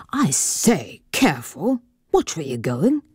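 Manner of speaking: lightly voiced gentleman, startled and put out; a hint of an annoyed older gentleman; gentleman's voice. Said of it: "a man's voice".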